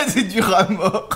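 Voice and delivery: laughing while chewing toffee. Real, not acted. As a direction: laugh through a mouthful of toffee.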